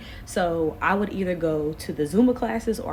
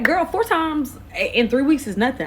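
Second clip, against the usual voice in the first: cocky high reply; 7 semitones higher, 4 dB louder than usual. Retold or imitated.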